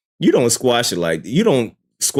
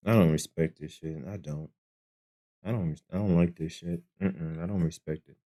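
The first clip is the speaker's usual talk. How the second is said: low, much softer disgusted muttering; dejected mutter; 7 semitones lower, 13 dB quieter than usual. Real, not acted. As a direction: grumble it to yourself, fed up. Disgusted after [name]'s apology track.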